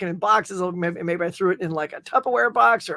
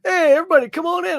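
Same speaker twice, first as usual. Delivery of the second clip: welcoming neighbor; 10 semitones higher, 5 dB louder, faster than usual. Retold or imitated. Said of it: "my neighbor was like".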